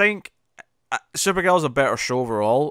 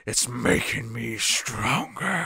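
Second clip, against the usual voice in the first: hammy villain line; 3 dB quieter, much slower than usual. Retold or imitated. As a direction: overact it like a bad villain. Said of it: "he's chewing the scenery".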